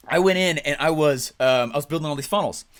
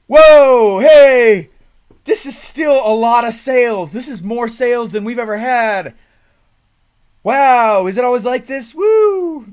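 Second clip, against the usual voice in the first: much higher, very loud exclaiming; amazed excitement; 8 semitones higher, 13 dB louder, slower than usual. Retold or imitated. Client on day two calling about sales, loud amazed whoop. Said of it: "they'd be like".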